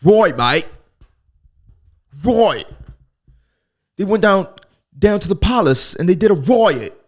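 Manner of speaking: loud hammed-up Australian, right said roit; mock Aussie bloke yelling about a riot; Australian accent. Mocking Australians, a loud hammed-up Australian accent. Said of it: "Australians be like".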